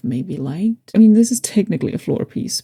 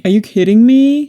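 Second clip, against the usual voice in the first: loud, high disbelieving joy; delighted disbelief; 4 semitones higher, 7 dB louder than usual. Real, not acted. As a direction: shout your disbelief happily.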